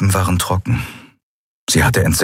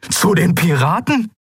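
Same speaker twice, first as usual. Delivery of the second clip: high, incredulous outcry; aghast disbelief; 13 semitones higher than usual. Acted. Kai challenges the admiral about the pirates, aghast. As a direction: throw the question up high, appalled.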